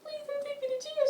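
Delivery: very high, pleading mock whine; friend begging. Caught friend begging the guard, high and whiny. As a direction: beg like she's about to be arrested.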